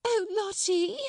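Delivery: high, flustered cry; screamed plea. A narrator's reading. Miss Amelia trying to hush Lottie, screaming. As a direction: cry out high over the wailing. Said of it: "screamed Miss Amelia".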